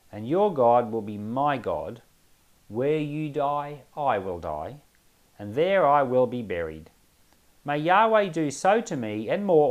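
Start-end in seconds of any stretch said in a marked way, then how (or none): none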